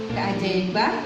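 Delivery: usual speaking voice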